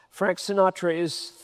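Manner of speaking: usual speaking voice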